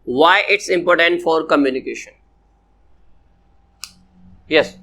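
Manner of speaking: ordinary talk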